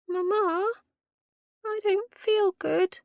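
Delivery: put-on high, slow, whiny small child; a sick little boy tugging at his mother; small boy complaining, high and slow. A narrator's reading. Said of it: "the boy complained".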